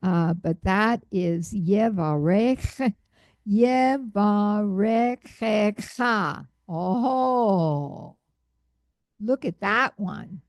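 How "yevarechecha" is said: Yevarechecha read slowly, each syllable spelled out; slow careful reading. Shown as a demonstration. Teacher reading slowly for the class. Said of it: "look at it as I read it again".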